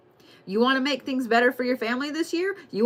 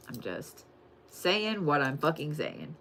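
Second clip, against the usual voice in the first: quiet mutter; 5 semitones lower, 9 dB quieter, slower than usual. Real, not acted. Quiet aside.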